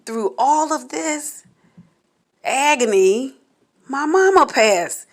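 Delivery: real tears as she announces her mother died; crying. Real, not acted. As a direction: fight through sobs to say it.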